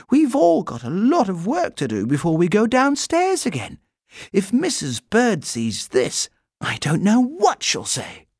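put-on high, urgent girlish warning; young girl voice. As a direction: warn him, a bit worried. Judy warning Paddington.

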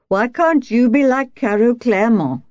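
put-on a touch of drunken bullying; beery raging husband. A narrator's reading. Wife imitating her husband comparing her, mild beery rage. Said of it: "his voice thick with beery rage".

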